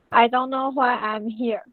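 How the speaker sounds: normal talking voice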